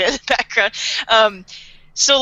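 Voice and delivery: ordinary talk, nothing marked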